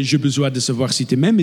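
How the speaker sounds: French spoken at the front of the mouth; forward-mouthed French pronunciation; French, front-placed. Shown as a demonstration. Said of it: "Like in French".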